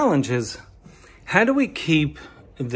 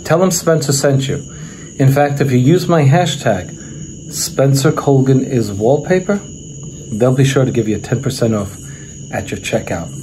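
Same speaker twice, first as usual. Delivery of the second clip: loud, brisk commercial pitch; sponsor ad read; 3 semitones lower, 7 dB louder than usual. Acted. Host pitching a sponsor to his viewers, loud ad-read voice. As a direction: sell the wallpaper store to viewers, punchy.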